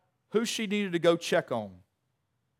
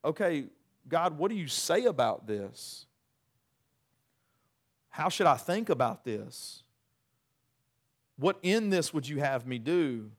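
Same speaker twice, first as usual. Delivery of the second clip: calm sincere prayer. Shown as a demonstration. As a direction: ask God gently, taking time. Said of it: "When really we should be saying".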